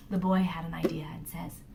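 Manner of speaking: hushed secretive narration; whispering. A narrator's reading. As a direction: whisper the idea.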